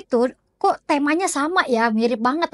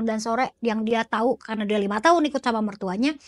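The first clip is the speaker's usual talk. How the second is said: normal talking voice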